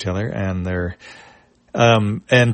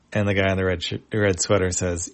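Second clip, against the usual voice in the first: ordinary delivery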